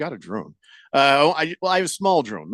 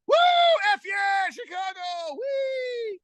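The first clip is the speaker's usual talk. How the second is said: very high, shrieking fangirl squeal; hammed-up high-pitched shriek of joy; mock squealing excitement; 4 dB quieter, slower than usual. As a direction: squeal it like a giddy fan who can't contain herself.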